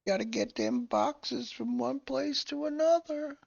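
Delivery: high, drawn-out cartoon worker voice; put-on box-moving worker. Joking with a mate about shifting boxes at work, put-on high worker voice.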